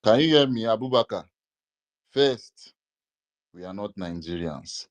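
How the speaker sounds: ordinary delivery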